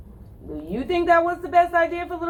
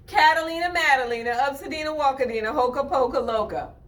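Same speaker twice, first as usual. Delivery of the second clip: quick name rattle; much faster than usual. Shown as a demonstration. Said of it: "You might know how to say it".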